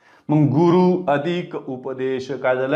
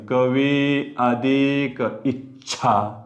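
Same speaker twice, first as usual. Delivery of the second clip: slow dictation; much slower than usual. Shown as a demonstration. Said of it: "बघा, हे".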